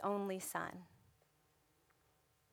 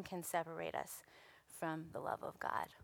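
whispered ending; 3 semitones lower, 7 dB quieter than usual. Real, not acted. Quiet close of sermon.

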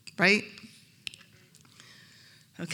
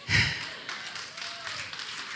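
high breathy sigh; 7 dB quieter than usual. Retold or imitated. Reaction after the rebuke.

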